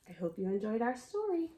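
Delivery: ordinary delivery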